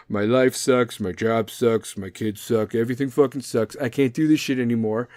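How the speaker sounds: lightly mocked weary complainer; a hint of defeated griping; midlife-crisis sufferer. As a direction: imitate a fed-up adult listing woes. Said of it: "midlife crises out there".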